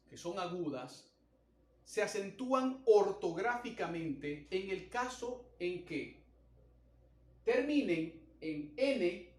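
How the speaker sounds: usual speaking voice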